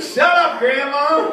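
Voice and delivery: loud, high jokey snap at grandma; yelling back. Real, not acted. Comic yells at a grandmother in the crowd, loud. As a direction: shout her down, laughing.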